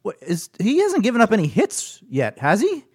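high, questioning retold voice; innocently asking the jinx question; Laura's voice. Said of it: "she goes".

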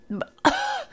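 high laugh through burning mouth; helpless laugh in pain. Real, not acted.

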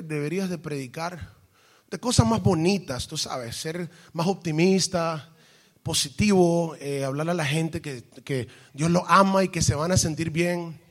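soft, sugary mock advice; retold acquaintance. Woman advising preacher to preach prettier things, softly imitated. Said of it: "Me dice".

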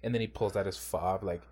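ordinary talk, nothing marked

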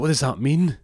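high, loud mock-bewildered question; baffled girlfriend. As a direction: imitate a girlfriend's incredulous demand. Baffled girlfriend replying to message.

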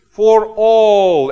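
ALL loud, high and drawn out; stress on ALL. Real, not acted. Preacher has everybody say all, booming stretched ALL. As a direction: belt out "all" and hold it long. Preacher drives home key word.

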